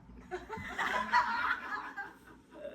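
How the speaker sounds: big laugh. Real, not acted. Laughing at his own drinking days. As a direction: crack up at the memory.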